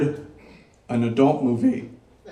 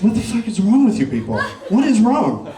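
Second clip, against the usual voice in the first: higher, incredulous rant at the crowd; exasperated outburst; 9 semitones higher, 4 dB louder than usual. Retold or imitated. Comic telling a crowd about Gen Z laughter, exasperated. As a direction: throw up your hands at their weird laughing.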